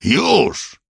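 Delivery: high, sudden yell. A narrator's reading. Killer demanding instant obedience, yell. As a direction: yell it as an urgent command. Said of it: "wydarł się morderca".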